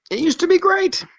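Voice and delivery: a mock high voice echoing that it used to be great; mocking put-on voice. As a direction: parody a fan pining for the old days. Repeated in a put-on voice.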